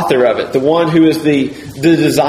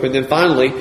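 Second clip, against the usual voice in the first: ordinary delivery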